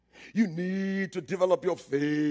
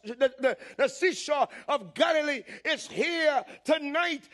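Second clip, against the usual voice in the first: high, rising preacher's cry; preaching at full force, pitched high; 7 semitones higher than usual. Real, not acted. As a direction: proclaim it to the whole hall.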